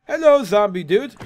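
loud, high teasing hello to a zombie; greeting a zombie in the game, loud and high; loud mock greeting. Real, not acted. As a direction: call out cheerfully to the monster.

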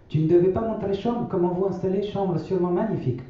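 grand-mère, voix bien plus aiguë et douce. A narrator's reading. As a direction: prendre la voix haute de la vieille grand-mère. (grand-mère qui répète sa maxime aux enfants, voix aiguë)

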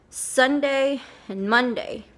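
ordinary talk, nothing marked

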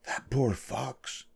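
slow, very soft whisper; hushed, confiding aside; storybook whisper. A narrator's reading. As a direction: whisper with pity, drawn out. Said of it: "he whispered to his wife".

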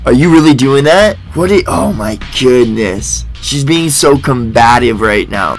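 normal talking voice

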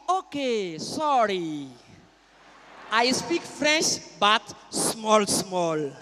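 slow Nigerian English, sinking at the end; Igbo Nigerian accent. Acted. Igbo man admitting his poor French, slow Nigerian accent. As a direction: apologise in a drawn-out Igbo accent. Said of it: "Il dit".

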